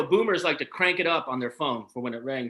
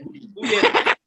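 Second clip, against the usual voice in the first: angry; 3 semitones higher than usual. Retold or imitated. Angry at the ding.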